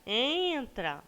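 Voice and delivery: "entra" called with a high, drawn-out EN; calling the word. Shown as a demonstration. Teacher shows pupils the stressed syllable, high long EN in "entra". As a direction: stretch and lift the first syllable of "entra". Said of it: "a sílaba tônica fica mais destacada".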